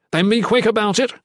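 high, loud command; impatient order. Acted. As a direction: hurry her along curtly. Ethan snaps an order.

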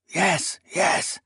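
distant voice calling through the walls; muffled call. Acted. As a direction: call out from another room, muffled. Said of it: "a muffled voice called".

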